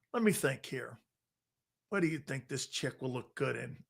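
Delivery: half-whispered pondering, as if alone; mock musing murmur. Musing which car for a date.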